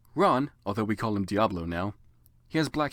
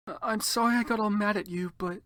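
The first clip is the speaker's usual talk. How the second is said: put-on girly apology; 10 semitones higher than usual. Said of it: "I expelled".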